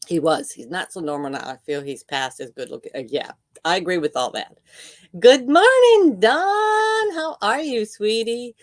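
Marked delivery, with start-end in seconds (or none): 5.2-8.6 sugary greeting. Retold or imitated. Mock sweet greeting voice.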